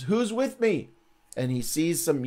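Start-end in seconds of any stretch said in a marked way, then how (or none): 0.0-0.9 high call up to the wall; rally anyone watching above. Retold or imitated. Jehu calling to the wall, high and rallying. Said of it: "at the wall and says".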